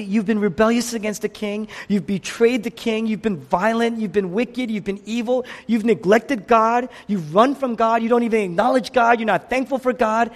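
high, insistent list of charges; impassioned accusation. Acted. Jesus to the rebellious city, high and accusing. Preacher voices Jesus listing the city's sins.

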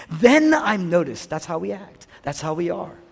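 ordinary delivery

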